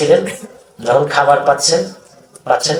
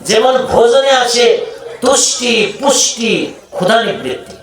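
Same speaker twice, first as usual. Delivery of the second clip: fervent preaching; 6 semitones higher than usual. Acted. Preacher summing up the gifts of Harinam, fervent preaching.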